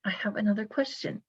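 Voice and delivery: a real whispered aside. Real, not acted. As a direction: drop to a whisper to move on. Interviewer softly moving on.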